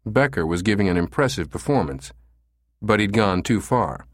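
usual speaking voice

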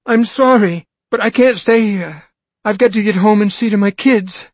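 a mother begging to get home; desperate plea. Acted. Frantic mother pleading.

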